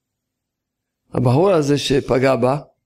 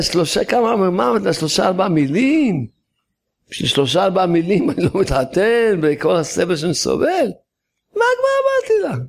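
higher, scoffing, brushing it all off; scoffing dismissal; 4 semitones higher than usual. Acted. Man brushing off his friend's warning, scoffing. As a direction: wave it away as nothing.

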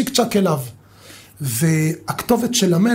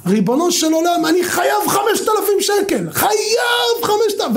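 retold desperate scream; 11 semitones higher than usual. A believer shouting his prayer to God for five thousand shekels. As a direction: scream it from the heart, pleading. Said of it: "אני צורח".

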